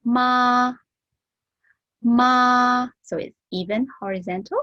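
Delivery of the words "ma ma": ma held level and high; ma, tono piatto e alto, sostenuto; first tone. Shown as a demonstration. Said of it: "the first tone will be ma".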